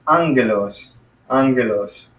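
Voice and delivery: ANgelos, weight on the opening syllable; first-syllable stress. Shown as a demonstration. Teacher showing the accent is on the front of "angelos", first syllable stressed. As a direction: stress the AN in "angelos" and let the rest fall. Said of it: "it's on the front end".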